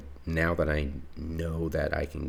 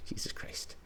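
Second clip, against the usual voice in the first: exasperated whisper; 9 dB quieter than usual. Real, not acted. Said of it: "I'm gonna scream".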